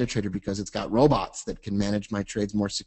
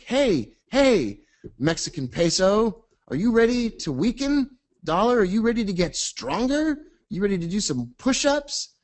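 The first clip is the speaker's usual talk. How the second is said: high, eager coach voice; pep-talk bell; 9 semitones higher than usual. Pretend. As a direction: pump them up like a gym coach.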